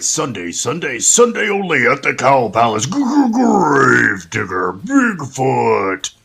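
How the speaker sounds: loud announcer, dragged out slowly at the end; monster truck ad. Pretend. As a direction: hype it like a stadium radio commercial.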